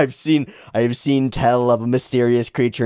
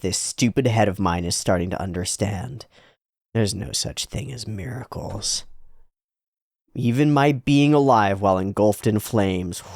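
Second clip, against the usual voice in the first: usual speaking voice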